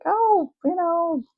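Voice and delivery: high, sing-song well-wisher voice; mock-cheerful and high-pitched; cheery well-meaning people. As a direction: imitate people breezily wishing you well, voice way up. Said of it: "when people were like".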